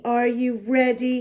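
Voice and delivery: plain reading voice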